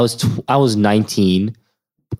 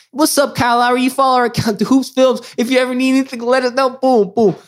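much higher, fast eager pitch; hyped high greeting; 14 semitones higher than usual. Retold or imitated. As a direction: sell it like an eager promoter. Hyped greeting to Kyle Lowry.

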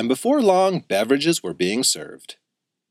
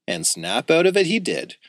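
ordinary delivery, nothing marked

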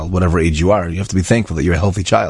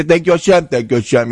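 higher, sing-song routine thank you; rote mock thanks; 9 semitones higher than usual. Mocking everyone's routine thanks to Hashem, sing-song. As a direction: rattle it off by habit, no feeling. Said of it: "But by you, it was so real".

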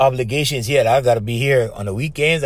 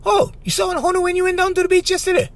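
much higher, fast, hammed-up parroting question; mock squeaky echo; faster than usual. Radio partner parroting the professor, squeaky mock question. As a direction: squeak the whole line back as a silly question. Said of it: "the other guy said".

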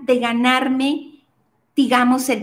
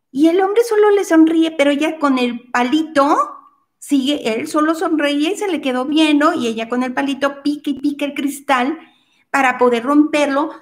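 ordinary talk

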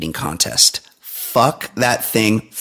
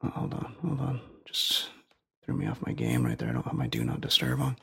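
quiet off-mic aside; muttered aside; 11 semitones lower, 15 dB quieter, faster than usual. Real, not acted. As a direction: turn away and mutter. Aside, phone interruption.

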